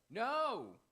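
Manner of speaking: very high, loud protest; shouted refusal. Acted. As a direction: act out a vehement forbidding. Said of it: "and you go".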